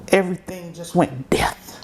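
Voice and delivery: words barely breathed out; a real, numb whisper; hushed whisper. Real, not acted. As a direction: whisper it, stunned and hollow. Recalls the news of mother's death.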